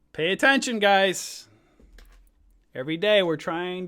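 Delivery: high, loud call to attention. Real, not acted. Streamer urging his viewers, loud and high.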